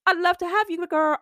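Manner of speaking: put-on squealing I'd love to have you, girl; excited welcome. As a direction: gush it like greeting a friend. Enthusiastic welcome to new clients.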